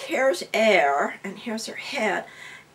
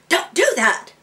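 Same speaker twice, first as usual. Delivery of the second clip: loud, high retold reprimand; sharp scolding; 10 semitones higher, 8 dB louder than usual. Teacher stopping a student taking on pain, scolding sharply. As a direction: cut someone off with a sudden scold.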